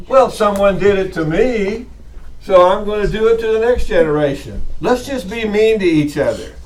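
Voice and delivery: mock justification. Teacher mocking people who think it's funny, sarcastic. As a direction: parody a petty grown-up's excuse. Sarcastic voicing of mean adults' logic.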